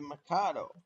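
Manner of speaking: Mikado as "muh-KAH-doh"; alternative pronunciation. Real, not acted. Player conceding the locomotive's other name, Mikado as muh-KAH-doh. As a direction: say Mikado with stressed KAH in the middle. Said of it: "I pronounce it Mikado".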